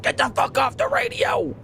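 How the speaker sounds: very high-pitched imitation of an enraged radio guy; hammed-up, strained high yell; mock furious outburst. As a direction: squawk it like a caricature of someone livid.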